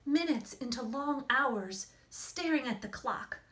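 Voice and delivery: gentle, hushed storybook reading; story narration. A narrator's reading. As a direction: read it like a bedtime picture book. Reads picture book aloud.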